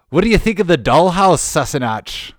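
hammed-up Scottish lilt with a fast question; Scottish accent. Reading the shirt's Scottish hero line in a mock Scottish accent. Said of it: "to be Scottish".

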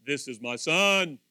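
slow, booming proclamation; voice of God. Acted. God speaks from the cloud about his son, booming voice. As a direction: stretch each phrase out, loud and weighty. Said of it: "the voice spoke".

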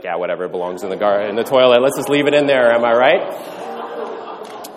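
fast, careless brush-off; dismissive shrug. Retold or imitated. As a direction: toss it away like it's junk. Careless Android owner voiced.

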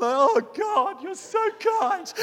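high, loud hammed-up weepy wail; mock tearful. Overcome by a children's film, crying out to God, mock tearful. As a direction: overdo being moved to tears, pitch soaring. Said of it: "I almost burst into tears every time".

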